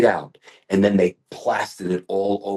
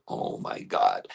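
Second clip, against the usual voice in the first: slow, lower, breathless whispered awe; awed whisper; 11 semitones lower, 6 dB quieter, slower than usual. Retold or imitated. Watching students' hair work at competitions, slow hushed whisper of awe. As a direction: whisper it, stunned by what you see. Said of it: "I go".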